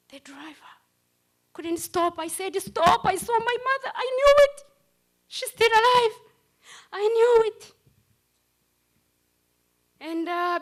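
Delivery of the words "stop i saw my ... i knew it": high, loud, breathless joy; excited shout. Retold or imitated. To the driver, a high excited shout on seeing mother. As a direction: shout it like you can't believe your eyes.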